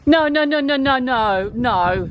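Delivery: loud, urgent no no no; alarmed no. Real, not acted. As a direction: stop the dog, loud. Dog found snake skin.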